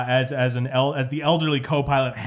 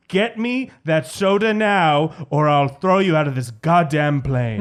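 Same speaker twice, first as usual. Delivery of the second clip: hammed-up bullying passenger; threatening demand; 4 dB louder than usual. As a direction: lay down a threat, word by word. Passenger drops politeness, threatens attendant.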